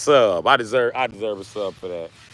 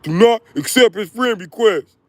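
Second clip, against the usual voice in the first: high, louder mock warning; 10 semitones higher, 5 dB louder than usual. Warning his mama in a high mock voice. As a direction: squeak it like a pretend warning.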